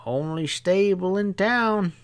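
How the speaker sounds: a hint of a knowing stableman; sly stableman. Stableman voice, higher.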